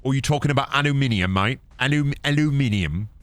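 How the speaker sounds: put-on British accent, teasing; mock British. Gamer fired up over aluminium, mock British accent. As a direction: play an indignant Brit with a mock accent. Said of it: "I make fun of the British".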